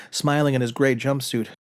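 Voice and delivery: plain reading voice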